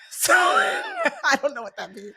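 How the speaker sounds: slow, high, laughing words. Real, not acted. Laughing over her niece's puzzling answer. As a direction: laugh it out helplessly.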